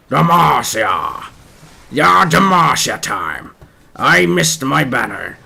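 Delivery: slow, drawn-out mock war cry; Jarvan battle cry. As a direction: bellow it like a hammed-up knight.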